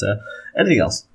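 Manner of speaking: normal talking voice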